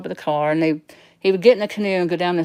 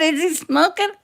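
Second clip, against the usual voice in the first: laughing while quoting; 12 semitones higher than usual. Retold or imitated. Laughing retelling her own question.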